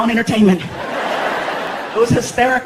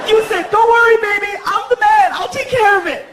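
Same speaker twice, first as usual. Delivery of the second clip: higher, louder mocking quote of his boast; girlfriend mocking; 10 semitones higher, 4 dB louder than usual. Girlfriend mocking his promise, higher louder mocking voice. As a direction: throw his promise back at him.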